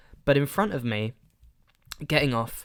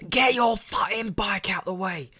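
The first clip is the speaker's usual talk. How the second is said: higher, hammed-up angry yell; retold shout; 9 semitones higher, 3 dB louder than usual. Narrator repeating the man's yell at the cyclist, mock shout. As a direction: bark it again, recalling the shout. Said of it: "Shouting, the whole train can hear".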